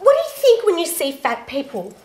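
usual speaking voice

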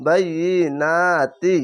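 বাইয়িনাতি with a drawn-out "naa"; one-alif stretch. Shown as a demonstration. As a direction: recite বাইয়িনাতি slowly, doubling the y. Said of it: "এক আলিফ টেনে পড়বে".

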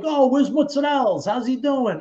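high-pitched, breezy imitation of a buddy; cheerful unaware friend. Cheerful unaware friend voiced on phone.

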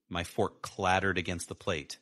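plain reading voice

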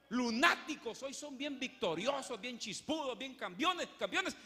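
loud sarcastic build-up; mock their fickle triumph; mocking loud preaching. Real, not acted. Se burla de los inconstantes. (burla fuerte y sarcástica)

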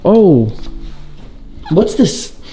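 normal talking voice

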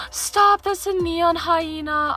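high squeal, then slow delight; excited shriek. Real, not acted. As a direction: gasp and squeal over a favourite. Sees favourite pet offered.

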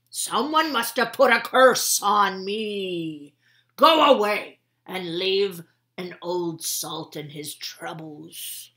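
loud, slow complaining sea captain; old captain grumbling to the frog about his bad day, loud and slow; grumpy old salt.

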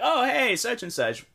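high-pitched, light mock hello; casual greeting. Greeting someone you recognize, casual and high.

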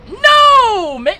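high, loud, drawn-out no; real yell of protest; alarmed cry. Real, not acted. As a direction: cry out as the blow comes in. Hit while playing.

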